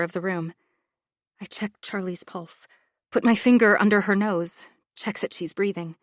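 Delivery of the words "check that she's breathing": ordinary delivery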